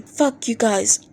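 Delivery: ordinary delivery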